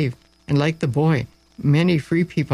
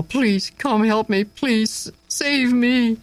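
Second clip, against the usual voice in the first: high, pleading cry for help; desperate plea; 7 semitones higher, much slower than usual. A narrator's reading. Slave calling toward the gate begging rescue, pleading high. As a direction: beg to be saved, voice lifting. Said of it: "Marcus cried toward the gate".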